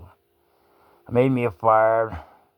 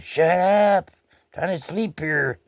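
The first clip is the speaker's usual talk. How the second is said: higher and louder, then slow; grumpy yell up at a bird; mock annoyed yell; 9 semitones higher, 3 dB louder, slower than usual. Yelling at the noisy dove.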